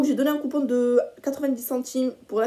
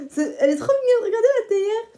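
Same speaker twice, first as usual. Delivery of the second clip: gushing; 10 semitones higher, 5 dB louder than usual. Real, not acted. Gushing over the teapot.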